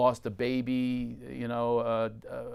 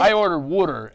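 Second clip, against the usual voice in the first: put-on Philly accent; 5 semitones higher, 11 dB louder than usual. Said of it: "it's an awful accent".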